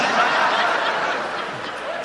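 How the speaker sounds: squeaky breathless giggle; high unvoiced laugh; high squealing laugh. Real, not acted. Laughing at his own mistake.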